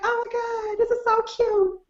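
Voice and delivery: high, slow cooing over the kicks; mock doting mom. Expecting mother feeling the baby kick, high cooing. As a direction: coo at the baby kicking. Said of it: "You're like".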